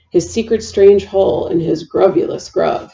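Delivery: plain reading voice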